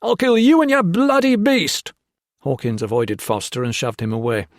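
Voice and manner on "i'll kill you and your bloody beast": high, loud murderous shriek; screaming. A narrator's reading. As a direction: scream it as you lunge.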